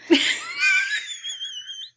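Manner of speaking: sudden shrieking laugh. Real, not acted. Laughing off a game suggestion.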